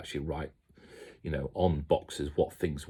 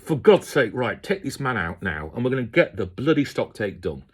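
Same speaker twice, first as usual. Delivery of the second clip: exasperated order; 5 semitones higher, 6 dB louder than usual. Retold or imitated. Retelling his own exasperated order.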